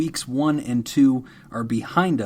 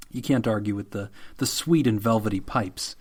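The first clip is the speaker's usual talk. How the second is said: usual speaking voice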